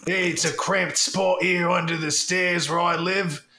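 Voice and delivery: hammed-up British kid ad read, drifting; British Donovan Potter accent. Sponsor spoof ad as Donovan Potter, British accent. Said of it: "make me accent inconsistent".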